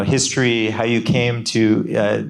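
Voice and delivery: ordinary talk, nothing marked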